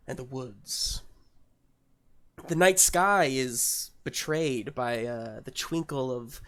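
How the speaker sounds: measured storyteller voice with long pauses; hushed, deliberate fantasy narration; scene-setting narration. Acted. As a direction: paint the scene slowly and grandly.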